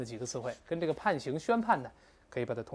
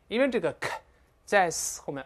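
normal talking voice

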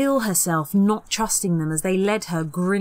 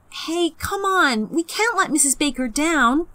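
put-on pleading girl; 10 semitones higher than usual. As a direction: beg them to stay. Jennifer pleading.

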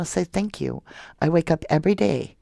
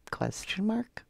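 breathy whispered question; 5 semitones higher, 10 dB quieter, slower than usual. Shown as a demonstration. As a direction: whisper it back, puzzled. Repeated as a whisper.

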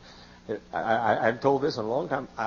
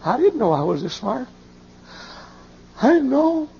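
high, loud astonished exclaim; startled self-praise; amazed at himself; 14 semitones higher, 5 dB louder than usual. Retold or imitated. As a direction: marvel out loud at your own smarts. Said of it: "and I said to myself".

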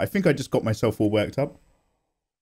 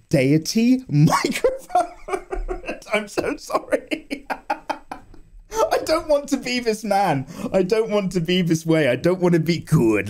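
high-pitched, giggling slow rant; high laughing rant; 8 semitones higher, slower than usual. Real, not acted. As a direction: squeak it out through laughter. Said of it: "remind myself to be angry".

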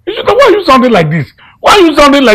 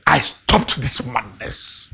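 low, slow and hushed; grim resolve; 10 semitones lower, 12 dB quieter, much slower than usual. Acted. Uncle vowing to stop the madness, grim resolve.

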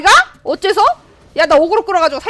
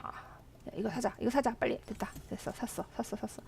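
hushed; 4 semitones lower, 19 dB quieter than usual. Real, not acted. 급히 속삭이며 구매.

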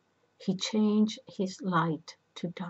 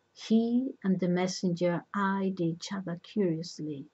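plain reading voice

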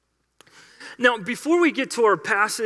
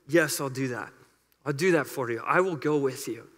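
much lower and softer, grave and calm; God answering; 7 semitones lower, 6 dB quieter than usual. Acted. God answering Moses' plea, low and quiet. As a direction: answer as a steady, solemn God. God's reply voiced low.

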